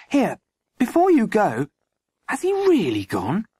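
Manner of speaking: high-pitched, insistent questioning; demanding. A narrator's reading. Landlady blocking the constable's way, demanding. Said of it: "she demanded".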